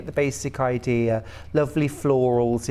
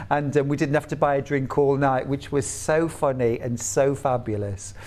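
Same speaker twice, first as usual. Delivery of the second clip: usual speaking voice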